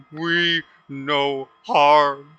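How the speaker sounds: loud, slow, booming tearful plea; a big creature pleading as it cries; through tears. Acted. Golem pleading with the party, loud and tearful. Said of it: "kinda through tears".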